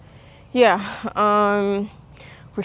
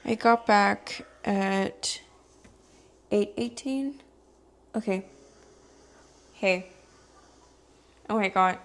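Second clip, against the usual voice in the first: softer hushed late-night talk; quiet; 8 dB quieter than usual. Real, not acted. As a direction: keep it down, people nearby. Talking late at night in the dark.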